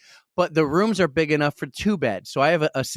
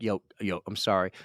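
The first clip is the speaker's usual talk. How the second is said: quiet; 8 semitones lower, 7 dB quieter than usual. Retold or imitated. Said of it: "I could just be like".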